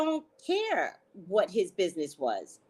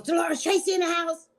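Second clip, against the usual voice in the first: loud, higher shout across the house; dad shouting; 9 semitones higher, 4 dB louder, faster than usual. Retold or imitated. Dad announcing Tracy to Dolores with a shout. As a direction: call out to her loudly. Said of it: "He goes".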